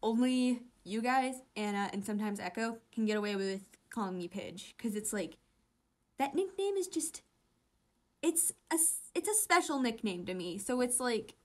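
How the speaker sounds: ordinary talk, nothing marked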